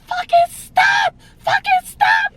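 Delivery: high-pitched strained acted shriek; screaming. Patient begging doctor to stop pulling, high screaming. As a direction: scream it twice, pleading in pain. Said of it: "Yo, I'm screaming".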